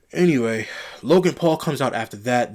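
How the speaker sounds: ordinary talk, nothing marked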